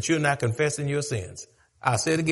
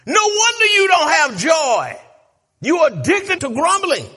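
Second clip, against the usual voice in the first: higher, louder rebuke; preacher scolding the congregation; preaching shout; 10 semitones higher, 6 dB louder than usual. Acted. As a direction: shout it across the pews.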